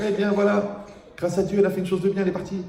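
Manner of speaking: high, quick comic pronouncement; mock eulogy. Mock epitaph for the complainer.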